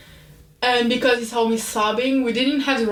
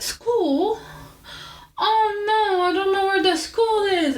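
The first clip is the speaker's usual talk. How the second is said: clueless stranger; 8 semitones higher, slower than usual. Retold or imitated. Strangers answering lost girl.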